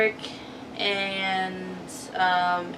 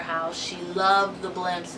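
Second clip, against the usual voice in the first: ordinary delivery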